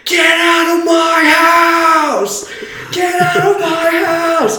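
high, drawn-out full-volume scream; loud, terrifying mock shriek; screaming at lungs' top. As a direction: imitate a night-terror scream, stretching every word. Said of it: "screaming at the top of his lungs".

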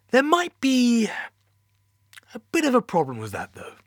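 edging toward bad news; nervous high voice. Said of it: "He sounded nervous".

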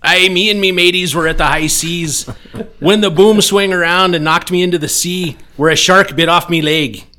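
loud, high pirate bellow; booming pirate voice. Acted. Pirate telling the insurance agent how he lost his leg, booming. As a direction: boom it like an old sea pirate. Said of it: "he says in a booming voice".

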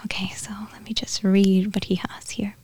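soft whisper to herself. Real, not acted. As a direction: whisper as if reading quietly aside. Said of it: "unvoiced whisper to self".

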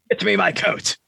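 high, haughty order to the butler; pompous master. Pretend. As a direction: bark at the servant. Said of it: "He's doing the voice of a butler".

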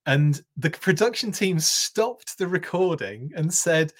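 usual speaking voice

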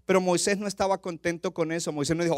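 ordinary talk

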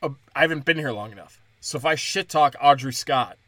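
usual speaking voice

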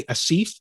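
ASIF said "a-SEEF"; long I. Retold or imitated. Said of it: "they make that I long".

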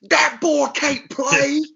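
high, slow drawled coach impression; mock old coach, way up high and stretched out; old Southern coach. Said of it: "he didn't sound like that".

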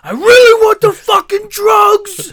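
yelling junkie craving drugs; loud harsh voice. Retold or imitated. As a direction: shout it like a desperate addict. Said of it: "You're like".

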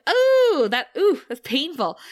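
high, loud pained ooh; sharp sympathetic ooh, fading; wincing ooh. Retold or imitated. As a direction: wince out loud at the injury. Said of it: "And I was like".

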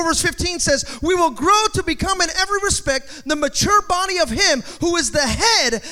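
loud, high-pitched preacher building in urgency; full-force preaching. Acted. Preacher urging the congregation to take up authority, full-force preaching. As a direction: drive it home like a fired-up preacher.

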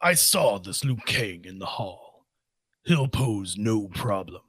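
low, slow monster growl of Goro. Pretend. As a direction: rumble it out like the four-armed brute. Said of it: "You wanna try your Goro?".

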